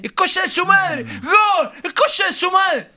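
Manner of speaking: very high, loud stadium celebration yell; grito de gol. Shown as a demonstration. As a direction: scream the goal and the curse with full release. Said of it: "cómo se gritan los goles en la cancha". (grito de gol desaforado)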